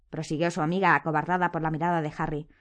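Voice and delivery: ordinary delivery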